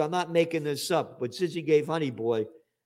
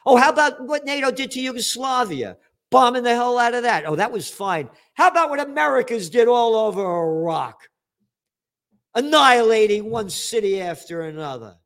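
higher, louder, heated accusation; outraged rant about bombings; indignant rant; 7 semitones higher, 5 dB louder than usual. Real, not acted. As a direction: hammer the hypocrisy home. Ranting about Western hypocrisy.

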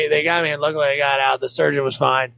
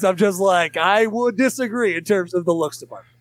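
normal talking voice

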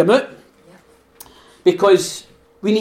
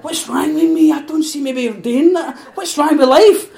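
higher, a hammed-up despairing question; mock self-pity; 8 semitones higher than usual. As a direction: play the sulking moaner, half laughing. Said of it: "You're going".

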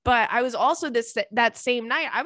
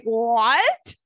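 loud, incredulous "what?"; retold disbelief; 6 dB louder than usual. Reacting to friend's story about speaking Russian, retold disbelief. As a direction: blurt it out in mock shock.

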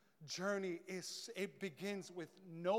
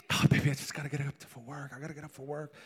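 low lovey voice; 8 semitones lower, 4 dB louder, faster than usual. Retold or imitated. Late night lovers' phone call.

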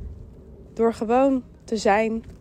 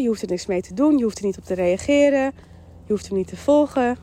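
ordinary talk, nothing marked

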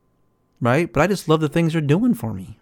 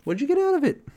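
incredulous; 13 semitones higher, faster than usual. Real, not acted. Rhetorical challenge.

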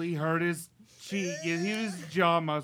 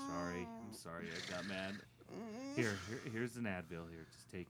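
soft apology; 7 semitones lower, 13 dB quieter than usual. Acted. Soft apology after outburst.

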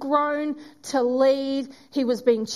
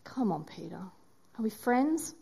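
softer and lower, tender plea; gentle coaxing; 3 semitones lower, 7 dB quieter than usual. Acted. Jesus gently coaxing ashamed Peter, soft and tender. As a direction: coax him back kindly.

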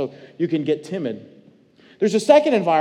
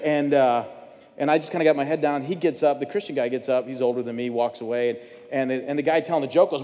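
usual speaking voice

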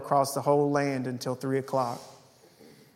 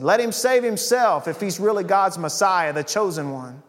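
scoffing; 4 semitones higher, 6 dB louder than usual. A narrator's reading. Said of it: "the leaders scoffed".

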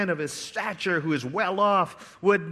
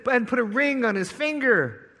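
urgent command; 7 semitones higher, 4 dB louder than usual. Acted. Father commanding servants.

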